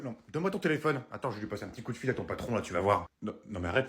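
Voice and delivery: ordinary talk, nothing marked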